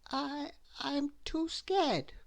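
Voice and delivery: high, slow, timid stammer; nervous squeak. A narrator's reading. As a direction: squeak it out, scared stiff. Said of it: "admitted Mister Jelly".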